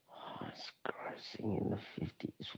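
half-whispered musing to oneself; very soft, low, mostly whispered pondering; thinking aloud. Real, not acted. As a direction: puzzle it over under your breath. Thinking aloud over the question.

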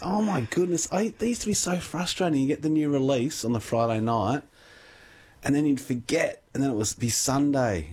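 high-pitched exasperation at a remembered annoyance; exasperated. Real, not acted. Video clerk griping about late fees, exasperated. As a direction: let the frustration push your pitch right up.

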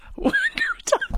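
laughing way up high; helpless high laugh; long high laugh. Real, not acted. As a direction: crack up laughing. Hilarious story.

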